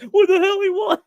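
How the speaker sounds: high, loud mock startle; startled outburst. James startled while packing chicken, high outburst. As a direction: imitate someone caught off guard. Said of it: "He must have caught him off guard".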